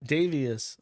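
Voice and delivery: ordinary talk, nothing marked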